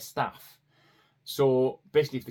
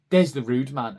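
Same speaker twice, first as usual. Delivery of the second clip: put-on child blurting; 2 semitones higher, 10 dB louder than usual. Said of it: "Daniel starts saying".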